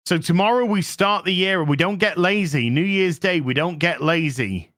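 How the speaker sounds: usual speaking voice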